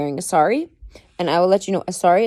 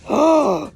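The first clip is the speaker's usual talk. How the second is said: gasp; 8 semitones higher, 4 dB louder than usual. Acted. Mock shock at the gossip.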